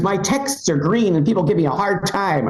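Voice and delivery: high, put-on aggrieved complaint; imitated yelling. Android-owning friend complains, mock yelling. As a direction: wail the grievance as an upset friend. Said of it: "My friend was yelling".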